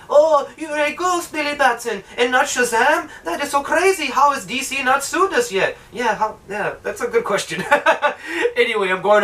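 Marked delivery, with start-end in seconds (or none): 0.1-5.8 high-pitched imitation of an amazed woman; hammed-up French girl, lifted high; mock girlfriend. Girlfriend marvelling at the ghost hero, mock amazement. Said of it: "she's like".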